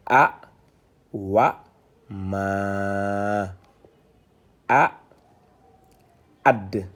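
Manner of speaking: syllable by syllable with clear pauses; isolated syllables, steady teaching demonstration; detached reading. Shown as a demonstration. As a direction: recite each sound separately, holding the long one. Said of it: "on est en détaché".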